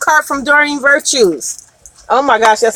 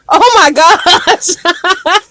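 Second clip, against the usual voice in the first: drawn-out, higher oh my gosh; overjoyed gasp; 4 semitones higher, much slower than usual. Real, not acted. Reader overjoyed for the client, slow delighted oh my gosh. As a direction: savor it in delight.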